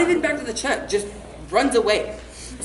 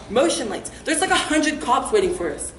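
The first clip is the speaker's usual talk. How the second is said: ordinary talk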